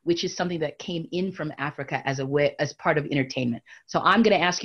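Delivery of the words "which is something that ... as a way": ordinary delivery, nothing marked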